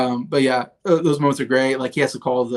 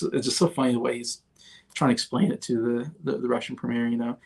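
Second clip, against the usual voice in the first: ordinary delivery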